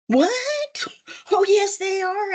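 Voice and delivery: high squeaky what, then insisting; startled what. Retold or imitated. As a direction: jump up high in disbelief, then deny it. Her own quoted protest.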